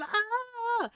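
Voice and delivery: high, drawn-out mock scream; imitated scream. Man screaming as he backs up, mock scream. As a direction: scream like a man backing away. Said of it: "screaming, going".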